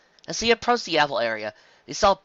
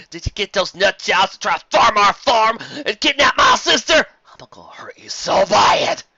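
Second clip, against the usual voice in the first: threatening shout; 9 semitones higher, 9 dB louder, slower than usual. Acted. Applejack threatening Carrot Top angrily.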